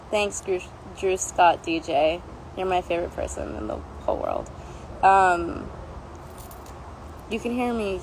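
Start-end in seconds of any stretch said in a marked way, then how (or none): none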